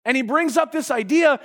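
normal talking voice

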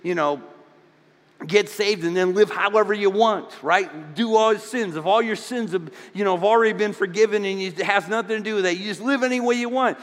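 hammed-up doubter arguing back; objecting listener. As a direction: voice the skeptic pushing back. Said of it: "some of you are pushing back".

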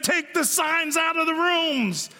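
high, slow, pleased-with-itself idea; smug marketer. Retold or imitated. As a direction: present the fix like a know-it-all.

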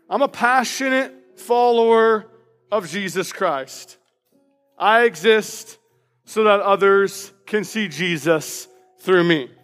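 usual speaking voice

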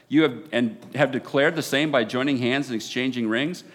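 loud, solemn declaration; formal pronouncement. Real, not acted. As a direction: proclaim it with ceremony. Officiant pronounces them married.

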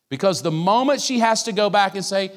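normal talking voice

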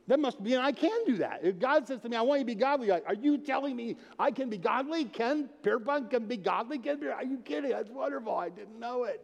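astonished, pitch held high throughout; acted amazement. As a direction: marvel aloud as if just told wonderful news. Acts out astonished response to God.

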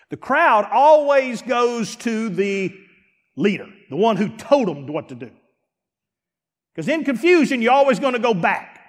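high, loud preacher's cry about being the odd one; fired-up preacher voice; full-force preaching. Acted.

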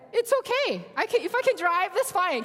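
high, loud, eager reassurance; retold eager insistence. Retold eager plea to car seller.

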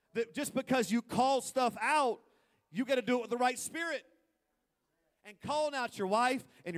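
loud and high, preaching hard; full-force preaching. Acted. Pastor preaching loudly to the congregation. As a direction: build the point like a revival preacher.

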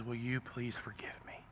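unvoiced, soft pleading prayer; hushed plea for forgiveness; whispered plea. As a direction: whisper it like a confession. David's prayer of repentance.

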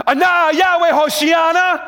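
full-force high, loud chant; crowd shout. Shown as a demonstration. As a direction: shout it to the whole city. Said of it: "Hear what it would be like".

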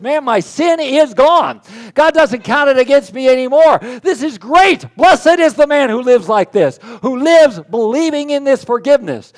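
high, loud joyful outburst, slow start; celebrating David. Acted. Pastor voicing David, celebrating sin gone: loud, high joy. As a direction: shout for joy like a forgiven king.

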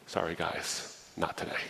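breathy near-whisper, voice barely there; hushed defeated whisper. Retold or imitated. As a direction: whisper it like admitting failure to your family. Jobless man telling his family.

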